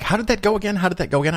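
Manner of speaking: high-pitched, eager imitation of a questioner; eager questioning. Young lady asks him to repeat the joke, eager high-pitched imitation.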